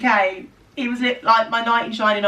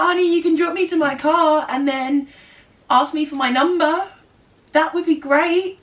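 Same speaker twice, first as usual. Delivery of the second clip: cheeky flirting; 7 semitones higher, 4 dB louder than usual. Retold or imitated. Flirty retold line to driver.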